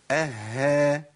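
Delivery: "أها" with a long held ه, then long aa; doubled haa with fatha. Shown as a demonstration. Teacher models the fifth case, haa doubled. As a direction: hold the breathy h in the middle of "أها". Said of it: "في الحالة الخامسة بقى".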